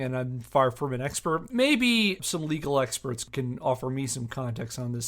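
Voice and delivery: ordinary talk, nothing marked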